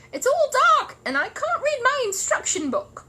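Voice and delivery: very high, tiny voice from inside a box; small muffled voice. Acted. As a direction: sound shut in and far away. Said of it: "small and muffled voice".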